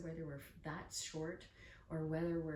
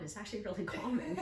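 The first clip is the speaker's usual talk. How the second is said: light laugh; 4 semitones higher, 5 dB louder than usual. Real, not acted. Laughing at herself.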